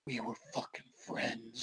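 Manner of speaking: very soft, low, menacing murmur; creepy hushed betrayal. Acted. Crazy-eyed homeless man stares at Bobby, creepy hushed accusation. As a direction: drop to a chilling near-whisper.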